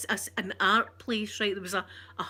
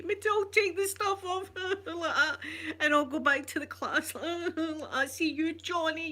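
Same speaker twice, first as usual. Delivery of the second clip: put-on higher, sulky kid glaring at Johnny; sulky child; 7 semitones higher than usual. Girl sulking back to class, eyeing Johnny, higher. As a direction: play the wronged little girl muttering threats.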